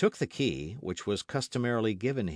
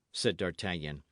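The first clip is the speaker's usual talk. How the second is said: usual speaking voice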